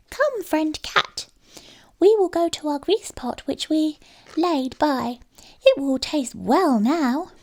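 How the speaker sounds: high, slow mouse voice, coaxing; a trusting mouse looking forward to the treat; sweet little mouse. A narrator's reading. Mouse invites cat to pot.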